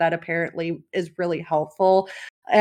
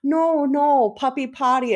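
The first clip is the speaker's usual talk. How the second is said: put-on toddler refusing; 6 semitones higher than usual. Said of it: "I'm like".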